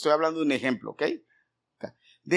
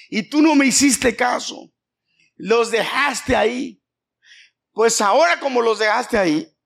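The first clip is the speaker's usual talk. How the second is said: stern rebuke; 3 semitones higher, 5 dB louder than usual. Acted. God rebuking his disobedient people, loud and stern. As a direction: lay down the law like an angry father.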